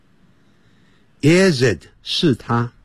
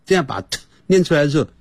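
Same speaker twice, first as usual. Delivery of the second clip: normal talking voice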